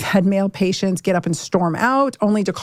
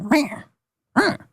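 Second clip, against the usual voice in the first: mock angry growl; 6 semitones higher than usual. Imitating defensive male patients, mock growling. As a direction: growl it like a touchy, irritable man. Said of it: "it is just kind of this".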